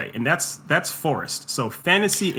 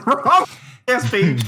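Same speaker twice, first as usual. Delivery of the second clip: laughing; 9 semitones higher, 5 dB louder, slower than usual. Real, not acted. Laughing at own slip-up.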